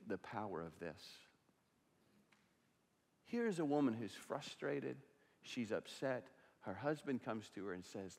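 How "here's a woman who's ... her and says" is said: ordinary delivery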